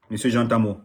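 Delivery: normal talking voice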